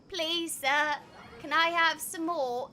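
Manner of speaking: hammed-up British begging; put-on British accent. Shown as a demonstration. Joking how Brits would react, pleading British accent. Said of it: "If I was like".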